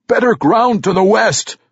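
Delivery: high-pitched call across distance; cried out. A narrator's reading. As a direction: call out directions to the party. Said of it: "Ragged Dane cried out from atop".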